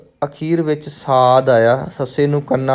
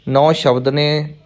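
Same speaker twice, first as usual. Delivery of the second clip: usual speaking voice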